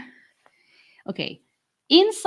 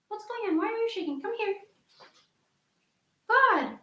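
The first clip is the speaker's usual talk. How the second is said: high, soft coaxing voice to a frightened dog; worried pet talk; 10 semitones higher, 8 dB quieter than usual. Real, not acted. Owner asks her shaking dog what's wrong, high anxious coo. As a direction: coo anxiously at your pet, much higher and softer.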